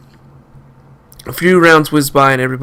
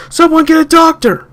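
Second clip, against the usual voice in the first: high, loud call for help; urgent yell; 13 semitones higher, 5 dB louder than usual. Acted. Bystanders by the wounded man: high urgent yell. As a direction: shout for a doctor. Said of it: "I kept hearing people yell".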